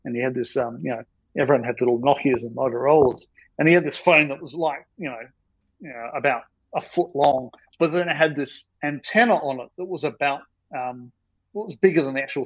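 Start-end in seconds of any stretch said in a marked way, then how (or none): none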